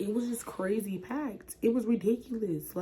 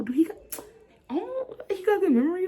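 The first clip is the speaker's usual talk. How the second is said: much higher, breathy disbelief; inner freak-out; 12 semitones higher, 5 dB louder than usual. Retold or imitated. Freaking out inside about Destin's memory, high excited. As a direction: squeal the question to yourself. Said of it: "freaking out on the inside".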